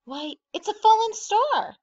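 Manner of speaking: high, loud exclamation of amazement; astonished gasp. A narrator's reading. Grandpa to the family at the fallen star, gasping in amazement. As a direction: cry out in astonishment. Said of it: "Grandpa gasped".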